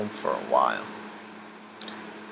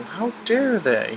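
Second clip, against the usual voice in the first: put-on high, slow, indignant gasp of a kid; mock outrage; 10 semitones higher, 3 dB louder, slower than usual. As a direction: act shocked on his behalf, drawn out. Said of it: "I'm sitting there going".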